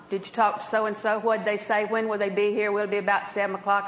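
quick, low, matter-of-fact questions; low-key and businesslike, quickly checking details; just asking for information. Shown as a demonstration. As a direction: rattle off practical questions without excitement. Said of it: "Different tone of voice".

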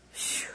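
soft, whispered exhale of relief; hushed sigh after a hard week; weary phew. Real, not acted. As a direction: blow out a quiet phew. Sighs about her hard week.

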